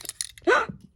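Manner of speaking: high startled gasp; sharp gasp. Real, not acted. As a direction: gasp as something drops. Loses guitar pick.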